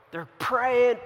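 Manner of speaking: ordinary delivery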